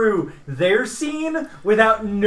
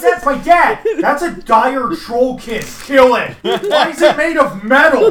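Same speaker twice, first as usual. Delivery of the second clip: excited; 4 semitones higher, 5 dB louder than usual. Acted. Alarmed reaction to trollkin.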